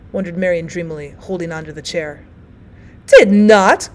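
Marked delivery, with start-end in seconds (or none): 3.1-3.9 loud protest; cried out. Acted. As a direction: cry it out in protest. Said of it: "cried the other old woman".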